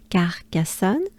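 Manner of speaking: normal talking voice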